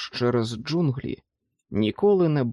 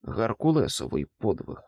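usual speaking voice

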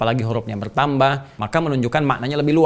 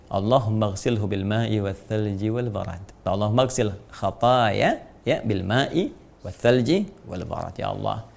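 slow, soft recitation of the dua; slow reverent Arabic recital; Arabic prayer recitation; 3 semitones lower, 3 dB quieter, slower than usual. Shown as a demonstration. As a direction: chant it gently and unhurried.

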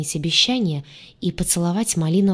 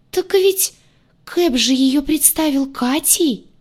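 high, thin, slow scared voice; frightened squeaky voice; 8 semitones higher, slower than usual. A narrator's reading. Redhead reminds the others about Katya, frightened squeak. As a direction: squeak it anxiously, drawing out the words. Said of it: "высоким и испуганным голоском пискнула рыжая".

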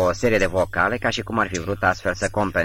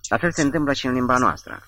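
higher-pitched, mildly delighted explanation; pleased explaining; 6 semitones higher than usual. A narrator's reading. Lu enlightens Kyo about recorded voices, mildly delighted. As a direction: explain it with a touch of glee, pitched up. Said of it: "se citea bucuria specific chinezească de a explica".

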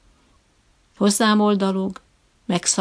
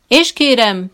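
loud, insistent lecturing tone; brooking no contradiction; 4 semitones higher, 9 dB louder, slower than usual. A narrator's reading. Trainer lecturing the visitor, insistent. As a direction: lay it down as the final word. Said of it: "ellentmondást nem tűrő hangon".

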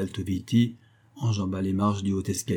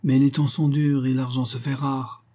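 higher, lightly moaning banker; mild poor-me complaint; whining; 5 semitones higher than usual. A narrator's reading. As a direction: plead hardship in a put-on whine. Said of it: "gémit le banquier".

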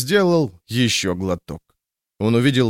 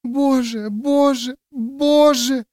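put-on panicked child prayer; much slower than usual. A narrator's reading. Girl prays in panic.

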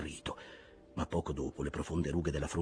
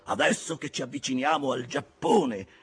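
building to a high, loud shout; indignant outburst; 14 semitones higher, 10 dB louder than usual. A narrator's reading. Ahab rejects repairing an old barrel, mounting outrage. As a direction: rise from raised voice into furious yelling.

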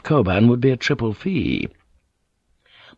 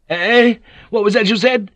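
high, loud startled outburst; shocked; 13 semitones higher, 4 dB louder than usual. Acted. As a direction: jolt up in disbelief. Shocked at the news.

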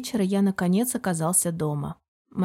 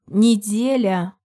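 loud, drawn-out single word; firm bark, stretched out; barked order; 6 dB louder, much slower than usual. A narrator's reading. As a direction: cut off the objection forcefully. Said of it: "рявкнул я так".

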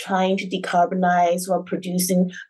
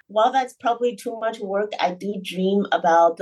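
usual speaking voice

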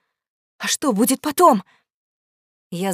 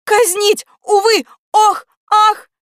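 loud, very high wails; mock sobbing; 11 semitones higher, 7 dB louder, slower than usual. Exclamation mark bewails the execution, sobbing theatrically. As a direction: wail each word in theatrical grief. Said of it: "зарыдал восклицательный".

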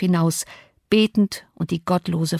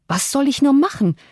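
higher, exasperated question; rising annoyance; 7 semitones higher, 3 dB louder than usual. A narrator's reading. Stranded guest asks the unresponsive household, with rising annoyance. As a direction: let irritation climb through the question. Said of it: "fuhr ich mit steigendem Ärger fort".

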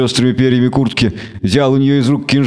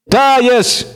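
much higher sharp shriek; shrieked elf word; 11 semitones higher than usual. A narrator's reading. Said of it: "взвизгнула Торвель".

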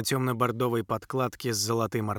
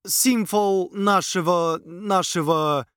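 slow halting prince, groping for words; stammering prince; 5 semitones higher, 4 dB louder, slower than usual. A narrator's reading. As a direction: drag the words, stuck mid-phrase. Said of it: "вновь стал заикаться он".

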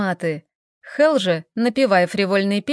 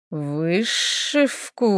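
slow indignant hiss; 3 semitones lower, 4 dB quieter, much slower than usual. Acted. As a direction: hiss the word in outrage. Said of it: "прошипел дракон".

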